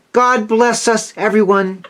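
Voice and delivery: put-on high little child's voice; small, high and earnest, like a poorly child; frail boy giving a blessing; sickly Tiny Tim. A narrator's reading. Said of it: "said the sickly-looking Tiny Tim".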